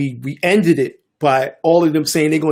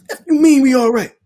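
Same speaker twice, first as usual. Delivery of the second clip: hostile retort; 10 semitones higher, 6 dB louder than usual. Retold or imitated. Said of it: "And he was".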